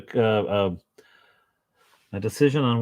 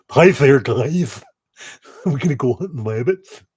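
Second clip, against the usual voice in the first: hammed-up buck-toothed critter greeting; 5 semitones higher, 7 dB louder than usual. Painter voices the buck-toothed figure to viewers, goofy buck-toothed voice. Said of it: "He looks like he is gonna buck-toothed".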